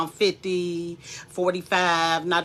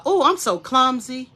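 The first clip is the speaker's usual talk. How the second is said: higher, acted-out exclamation at oneself; mock self-reproach; 6 semitones higher, 4 dB louder than usual. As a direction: play at chiding yourself after a fumble. Acts out scolding oneself after dropping.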